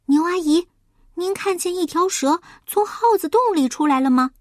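high, urgent piglet voice; anxious. Acted. Little pig asks Auntie Cow about the snake, anxious. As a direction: ask urgently, pitched well up. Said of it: "稀里呼噜非常着急".

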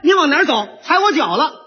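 mock pre-fight sneer; cold sneer. Shown as a demonstration. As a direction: challenge them with a cold smile. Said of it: "就用着这个冷笑了".

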